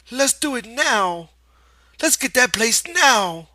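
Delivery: put-on high, loud, slow childlike pleading; begging Lenny. A narrator's reading. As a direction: beg like an impatient child. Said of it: "Lenny begged".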